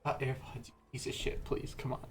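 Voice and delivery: hushed, voiceless muttering; exasperated whisper. Real, not acted. Cursing the failing AirPods, exasperated whisper. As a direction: plead under your breath at a broken gadget.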